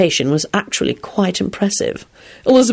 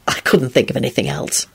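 lower, softly awkward excuse; embarrassed; 4 semitones lower, 3 dB quieter than usual. A narrator's reading. Lupin excusing his choice of costume, embarrassed. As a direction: explain yourself sheepishly. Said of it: "with some embarrassment".

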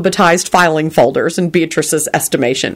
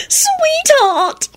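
very high, gushing endearment; adoring. A narrator's reading. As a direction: squeal it fondly at your lover. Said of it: "Solange beamed at him".

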